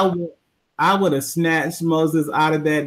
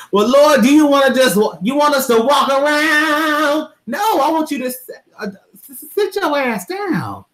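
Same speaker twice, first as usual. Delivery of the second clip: much higher, loud mocking church-lady voice; old church folks; 11 semitones higher, 4 dB louder than usual. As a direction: squeal it like a fussy old church member. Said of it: "old religious old church folks will be like".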